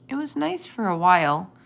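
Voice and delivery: usual speaking voice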